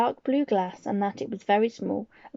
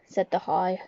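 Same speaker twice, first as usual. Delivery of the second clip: ordinary delivery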